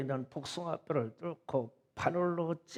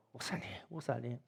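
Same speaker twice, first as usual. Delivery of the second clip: whisper; 2 semitones lower, 11 dB quieter, faster than usual. Retold or imitated. Kids whispering to the pastor.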